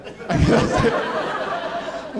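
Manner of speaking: helpless laughing fit; can't stop laughing; breathy laugh. Real, not acted. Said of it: "See, I can't say it without laughing".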